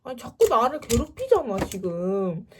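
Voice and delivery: loud, high exasperated outburst; fed up. Retold or imitated. Student fed up with the ex's calls, loud exasperation. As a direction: burst out in annoyance, then ease off. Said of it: "너무 열받아가지고".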